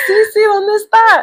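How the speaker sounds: high, eager retold question; eager reply. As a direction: imitate an urgent, eager yes.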